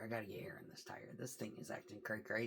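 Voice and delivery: fast whisper to camera; whispered aside. Real, not acted. Trucker confiding to camera, fast whisper. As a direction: let us in on it quietly.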